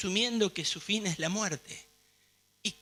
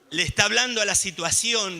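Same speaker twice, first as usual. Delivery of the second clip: ordinary talk, nothing marked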